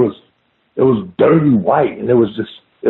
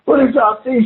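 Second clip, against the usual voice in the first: retold tearful scream, pitched way up; screaming and crying; 11 semitones higher than usual. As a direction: imitate a hysterical woman demanding answers. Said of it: "She's screaming and crying".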